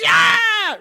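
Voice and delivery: loud, very high movie-victim scream; mock Wilhelm scream. Film fan acting out a jungle-machine death, screaming. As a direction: scream like someone falling into giant blades. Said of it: "Wilhelm scream".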